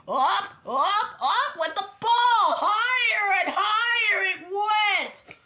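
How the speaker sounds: loud, high, stretched-out climbing narration; swelling, thrilled storytelling; excited build-up. A narrator's reading. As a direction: send each word soaring with the ball. Ball soaring higher in the story.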